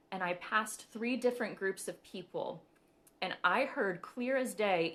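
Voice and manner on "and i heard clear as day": ordinary delivery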